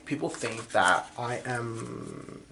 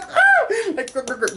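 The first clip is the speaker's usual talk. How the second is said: cutesy growl. Retold or imitated. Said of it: "Like, he's like".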